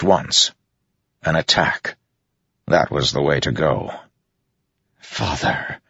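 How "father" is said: hushed, stunned whisper of a son. A narrator's reading. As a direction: whisper it softly, under the breath. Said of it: "Adolin whispered".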